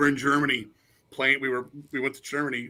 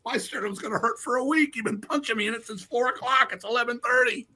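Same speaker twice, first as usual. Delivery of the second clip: pitched way up, exasperated retelling; 9 semitones higher than usual. Retold or imitated. Singer scolding the drunk fan who kept punching him, high and exasperated. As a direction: play the fed-up singer, voice high. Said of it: "I'm like".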